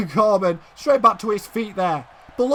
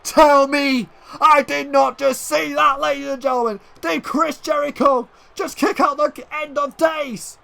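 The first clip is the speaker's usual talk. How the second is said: stunned disbelief; 6 semitones higher than usual. Real, not acted. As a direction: exclaim as if you can't believe the kick-out. Disbelief at kick-out.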